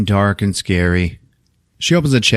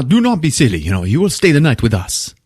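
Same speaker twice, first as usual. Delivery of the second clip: hammed-up insistence, starting high; insistent host; 5 semitones higher than usual. Host overrules the departing doctor, mock-grand insistence from a high start. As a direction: imitate an overbearing generous host.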